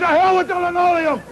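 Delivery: father yelling at mother, hammed-up outburst; angry outburst. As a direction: blow up like the father in his rage. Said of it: "He said".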